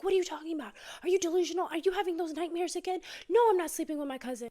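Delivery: high, loud mock-defensive protest; defensive denial. Husband, woken and accused, indignantly denies everything.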